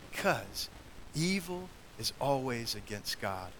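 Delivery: ordinary delivery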